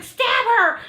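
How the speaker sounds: high, loud retold order; urgent command. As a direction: call it out as an emergency order.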